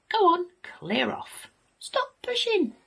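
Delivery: high-pitched mother pig; bossy shooing. A narrator's reading. Mother pig sending her piglets on their way, bossy shooing. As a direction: shoo them out in a high voice.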